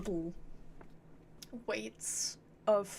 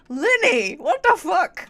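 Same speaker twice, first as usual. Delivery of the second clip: loud, high exasperated yell; exasperated scolding; 8 semitones higher, 11 dB louder than usual. Real, not acted. Streamer scolding Linny for his pronunciation, loud and exasperated. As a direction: call out the culprit, loud and high. Scolding the game character loudly.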